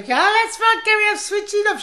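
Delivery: high-pitched mock old-lady voice; dotty old dear. Old woman in the kitchen assuring Gary, high dotty voice. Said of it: "this old dear. She was completely mad".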